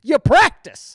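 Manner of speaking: loud, high insistence; emphatic shout. Real, not acted. Ranting at the scolding mom, loud emphatic shout. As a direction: hammer it home loudly. Heated rant at park mom.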